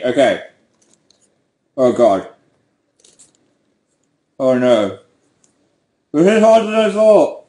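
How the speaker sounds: talking round a pen held in teeth; words muffled by a pen between the teeth; pen in mouth. Real, not acted. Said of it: "I can't do the mouth thing anymore".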